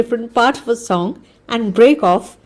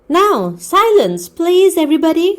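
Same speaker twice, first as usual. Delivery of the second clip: hostess calling out; 9 semitones higher, 3 dB louder than usual. A narrator's reading. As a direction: hush the whole room, high and bright. Hostess calling the room to order.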